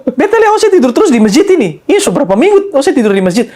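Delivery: lightly voiced old man in an Ambon accent. Old man questioning the sleeping narrator, Ambon accent.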